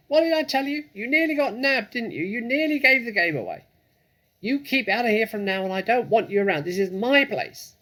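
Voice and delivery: high, loud shrieking rat voice. Acted. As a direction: screech it down from the shelf like a furious rat.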